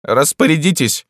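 high, loud imperious command; barked order. A narrator's reading. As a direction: bark it like a ruler. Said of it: "рявкнул император".

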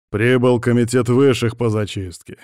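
usual speaking voice

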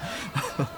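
a quick, airless little laugh; short sheepish breathy laugh. Acted. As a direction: laugh off your own forgetfulness. Laughing at his own forgetfulness.

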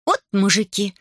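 put-on high scoffing girl's voice; snorting scornfully. A narrator's reading. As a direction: scoff at men in general. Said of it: "фыркнула Берута".

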